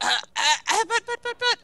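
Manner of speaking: high, stammering plea; mock flustered protest; pathetic begging. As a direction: sputter helplessly as he walks away. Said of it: "how pathetic you want to sound begging him".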